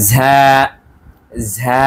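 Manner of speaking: ঝা pressed out with breath from inside; ঝা said with pressure and air from within; Arabic letter ঝা. Shown as a demonstration. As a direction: push ঝা out with a little pressure and air. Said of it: "একটু চাপ দিয়ে, ভেতর থেকে একটি বাতাস বের হবে।".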